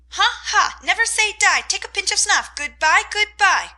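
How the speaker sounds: very high, loud parrot squawks; squalling parrot. A narrator's reading. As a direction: screech and cackle like a dancing bird. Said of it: "squalled Polly".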